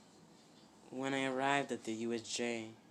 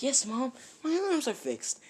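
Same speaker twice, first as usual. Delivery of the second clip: high, eager repeat; high happy insistence to mother; excited insisting; 12 semitones higher than usual. Acted. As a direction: tell Mom again, thrilled. Deku insists happily.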